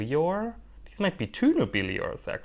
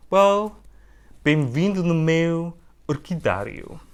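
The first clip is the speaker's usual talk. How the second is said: higher, louder put-on Portuguese welcome; Portuguese greeting; 6 semitones higher, 6 dB louder than usual. Welcoming viewers to her orchid room in a playful Portuguese accent. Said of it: "Excuse my offensive use of Portuguese".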